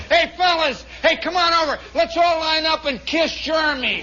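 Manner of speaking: high, loud call to the gang; shouted mocking invitation; rowdy rallying shout. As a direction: holler everyone over for the joke. Heckler rallying the men.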